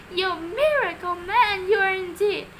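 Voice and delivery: excited cry. A narrator's reading. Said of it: "Missus Silver cried".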